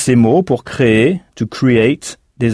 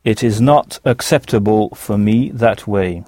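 "It is not acceptable for me that way." said slowly, word by word; slow repeat for learners; 2 semitones lower than usual. Shown as a demonstration. Teacher repeats the model sentence slowly. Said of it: "comment direz-vous".

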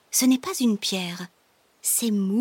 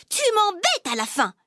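high, exasperated snap; acted sibling anger, pitched up; annoyed outburst; 10 semitones higher than usual. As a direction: lose patience with a little brother. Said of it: "Lola s'énerve à son tour".

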